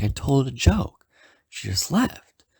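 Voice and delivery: mock exasperated, high and drawn out; pissed off. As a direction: imitate a friend complaining in disbelief. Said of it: "he's pissed".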